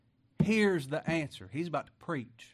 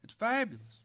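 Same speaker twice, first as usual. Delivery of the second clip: usual speaking voice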